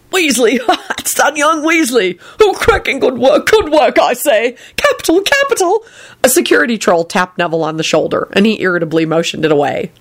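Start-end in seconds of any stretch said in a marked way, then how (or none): 0.1-5.9 put-on high, jolly old-chap onlookers; hearty, high-pitched congratulations; chuckling passers-by. A narrator's reading. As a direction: cheer on the brawl with amusement. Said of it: "several passers-by began chuckling".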